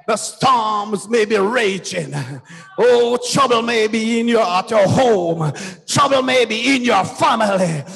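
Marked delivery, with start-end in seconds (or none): none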